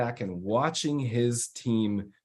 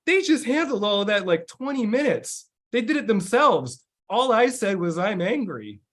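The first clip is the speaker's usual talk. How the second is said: much higher, awed voice; amazed client; 7 semitones higher than usual. Retold or imitated. Client telling his coach how the team handled it, high and awed. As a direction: marvel at the team, pitched way up.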